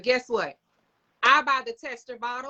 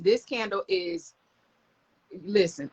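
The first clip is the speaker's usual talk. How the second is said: ordinary talk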